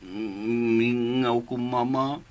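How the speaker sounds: slow, low and soft; quiet, halting sadness; sad longing. Acted. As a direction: murmur it low, missing mama. [name] says he misses his mother.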